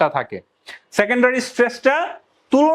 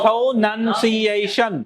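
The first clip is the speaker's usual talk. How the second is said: Pronunciation slowly spelt out in five syllables; slow, measured breakdown of Pronunciation; much slower than usual. Shown as a demonstration. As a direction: separate each syllable of Pronunciation evenly. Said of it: "syllable এখানে ভাঙা আছে".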